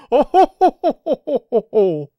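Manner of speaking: high, loud triumphant cackle; villainous laugh. Acted. Villain laughing at Kid's defeat.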